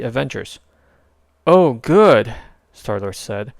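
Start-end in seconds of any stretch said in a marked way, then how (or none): 1.5-2.3 higher, louder wry hero; a cocky wisecrack; sarcastic relief. A narrator's reading. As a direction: hero greets the arriving Avenger. Star-Lord voice.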